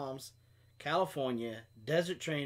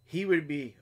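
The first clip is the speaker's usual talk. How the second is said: ordinary delivery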